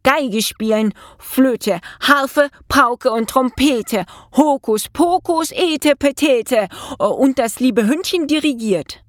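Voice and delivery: high incantation with drawn-out magic words; called-out spell. A narrator's reading. Mouse conjures a hen orchestra, exuberant spell. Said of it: "rief er".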